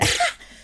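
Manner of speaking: a brief scornful laugh; one dry chuckle; short laugh. Acted. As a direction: laugh off the order. Mocking the hero's command.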